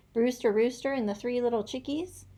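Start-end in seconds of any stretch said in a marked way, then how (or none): none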